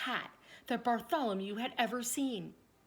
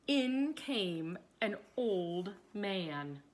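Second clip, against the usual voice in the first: usual speaking voice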